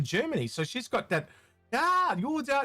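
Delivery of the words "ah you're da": put-on much higher, a brief touch of German accent; light mock German accent. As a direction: clip a German-accented phrase mid-word. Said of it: "that little bit of the German".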